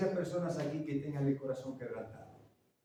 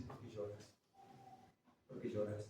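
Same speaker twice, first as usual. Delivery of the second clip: tender comforting; 7 semitones lower, 15 dB quieter than usual. Acted. The Lord comforting the weeper.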